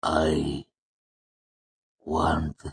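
long gaps between drawn-out words; dazed mercenary struggling to speak; slow, thick voice. A narrator's reading. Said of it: "his voice slow and thick".

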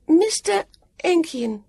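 high, slow echo of "Mister Enkian"; high call with a break between the words; calling back. A narrator's reading. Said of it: "Sam called back".

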